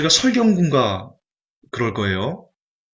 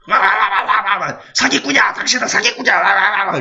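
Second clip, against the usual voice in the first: higher mock barking that turns into accusing words; barking dog; 5 semitones higher than usual. Dog barks at the swindling pastor in court, mock barking. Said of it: "이렇게 막 짖어요".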